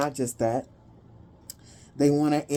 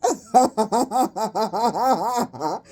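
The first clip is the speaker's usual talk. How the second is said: long falling giggle; laughing; 3 semitones higher than usual. Real, not acted. Reader breaking down mid-reading, laughing. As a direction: let a helpless laugh roll down. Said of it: "I'm not gonna laugh".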